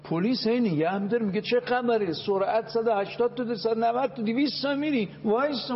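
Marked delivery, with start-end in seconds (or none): 1.4-5.8 higher, scolding cop stopping a speeder; scolding traffic cop. Retold or imitated. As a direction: call out the speeding driver like a cop. Mock police stopping a speeder.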